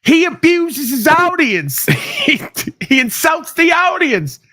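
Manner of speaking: exaggerated high mocking imitation, dragged out; mocking Barry voice. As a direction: squeak it out slowly to ridicule him. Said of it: "He goes".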